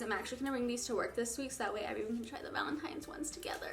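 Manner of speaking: unvoiced whisper, confiding plan; soft whisper to the viewer. Real, not acted. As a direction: tell it like a secret. Whispered plan about sharing tea.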